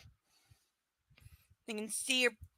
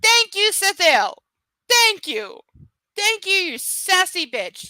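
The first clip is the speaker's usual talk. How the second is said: excited thanks; 10 semitones higher, 10 dB louder, slower than usual. Real, not acted. Gushing thanks to chat viewer.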